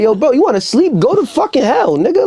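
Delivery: high, hammed-up furious rant; damn the sleeper in a high mock rage; mock fury. Cursing a friend for sleeping, high hammed-up fury. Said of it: "It's like".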